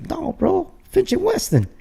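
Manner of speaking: very high, excited hometown shout-out; retold excited correction; proud retort. Acted proud answer about hometown.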